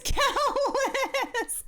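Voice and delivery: high squeaky laugh through the word; bursting into laughter. Real, not acted. Laughing at own song habit.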